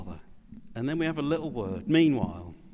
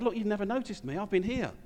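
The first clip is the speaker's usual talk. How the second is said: hurt resentful son; 6 semitones higher than usual. Acted. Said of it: "He's resentful".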